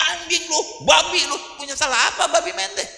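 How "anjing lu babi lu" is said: mock angry cursing. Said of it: "kalau ngamuk".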